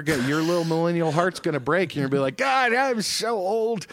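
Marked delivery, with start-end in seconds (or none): 2.4-3.9 put-on slow, drawn-out whiny sigh of old age; mock lament. Voicing a future millennial's lament.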